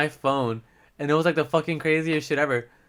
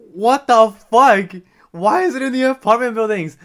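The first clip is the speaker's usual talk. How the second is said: retold shocked yell; 9 semitones higher, 8 dB louder than usual. Drunk guy learning a stranger has his phone, shocked yell. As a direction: bellow it in drunken disbelief. Phone located in apartment buildings.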